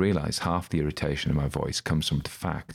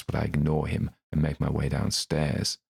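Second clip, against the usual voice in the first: usual speaking voice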